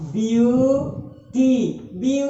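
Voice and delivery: Beauty stretched very slowly, each syllable held. Shown as a demonstration. Teacher showing the syllable count, beauty split slowly. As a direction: count out beauty, view as separate long syllables. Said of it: "इसको तोड़ के देखिए".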